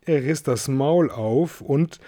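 ordinary delivery

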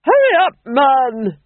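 high, loud impatient order; impatient Duchess. A narrator's reading. Duchess orders the Fool to hurry, impatiently. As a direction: hurry a servant along loudly.

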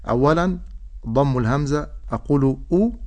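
ordinary delivery

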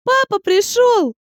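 put-on high, slow joyful child's cry; a little girl calling out with joy; excited little girl. A narrator's reading. Girl runs to her dad.

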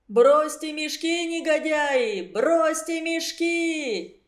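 выше, медленно, угрожающий крик; грозно кричать, растягивая; кричит вслед. Acted. (угрожающий окрик)

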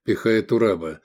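plain reading voice

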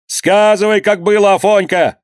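high-pitched urgent calls; narrated cries of many voices; crowd shouting. A narrator's reading. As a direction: call out from the crowd to stop them. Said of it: "кричали сзади".

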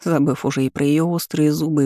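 ordinary delivery

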